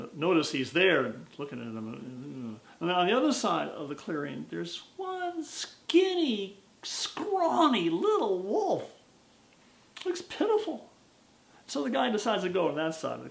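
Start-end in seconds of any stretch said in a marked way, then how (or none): none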